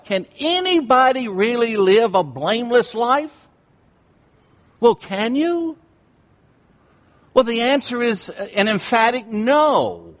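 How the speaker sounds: full-force sermon voice; fiery preaching. Acted. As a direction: preach it hard to the congregation.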